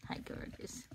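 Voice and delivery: soft whispered greeting to a puppy; tender whisper to a pet; whispered hello. Real, not acted. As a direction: breathe a gentle hi.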